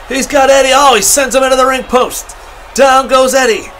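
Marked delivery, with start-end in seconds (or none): none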